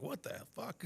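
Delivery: high-pitched mock disbelief; retold bafflement. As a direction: imitate someone thrown by what they heard. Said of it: "He really was like".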